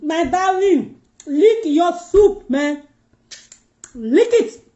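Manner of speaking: high and very slow, drawn-out coaxing; coaxing drawl. Real, not acted. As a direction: stretch it out sweetly, urging her on. Coaxing a viewer to lick soup.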